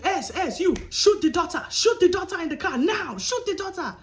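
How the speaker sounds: barking orders at henchmen; commanding. Retold or imitated. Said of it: "Literally commands the other two".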